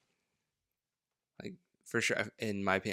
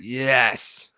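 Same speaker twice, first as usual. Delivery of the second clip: loud, higher, hammed-up yes; mock-fervent convert; 4 semitones higher, 10 dB louder than usual. Playing a fan won over by the guru, mock-fervent shout. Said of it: "And you're like".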